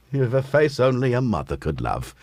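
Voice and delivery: ordinary delivery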